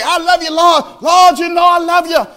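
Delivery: high, loud, overdone declarations of love; mock gushing believer. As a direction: ham up the empty praise. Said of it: "You don't have to say".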